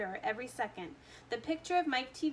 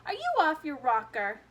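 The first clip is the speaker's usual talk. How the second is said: louder, scoffing cry; exasperated cry; 4 semitones higher, 7 dB louder than usual. A narrator's reading. Candy maker scolding the boy, a loud scoffing cry. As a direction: scoff at the crazy idea. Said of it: "cried Mister Wonka".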